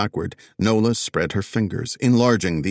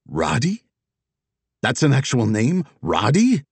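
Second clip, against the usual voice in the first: confused disbelief; 5 semitones higher, 3 dB louder than usual. Acted. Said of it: "He looked lost, confused".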